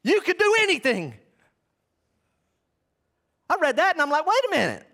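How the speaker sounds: loud, high-pitched pulpit proclamation; impassioned preaching. Acted. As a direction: preach at full force, drawing out key words. Preaches at full force.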